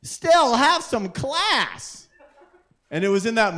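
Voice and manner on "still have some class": high, slow prim mother; prim mom. Retold or imitated. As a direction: draw out the reproach like a proper lady. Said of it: "she's like".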